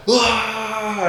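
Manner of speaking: a high mock scream from the basement; mock power-up scream. Friend screaming in basement, retold as high mock scream. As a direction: imitate a friend powering up with a yell.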